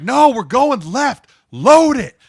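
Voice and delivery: high, drawn-out retold shout; imitated yelling, very loud and high; Nick. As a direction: yell back, refusing to give in. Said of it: "they're just yelling at each other, dude, like, screaming".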